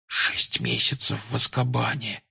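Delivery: slow, hushed disbelief; shocked whisper. A narrator's reading. Harry stunned at the Azkaban sentence, hushed. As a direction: breathe it out slowly, stunned. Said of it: "прошептал потрясённый Гарри".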